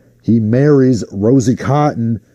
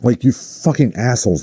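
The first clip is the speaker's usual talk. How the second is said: ordinary delivery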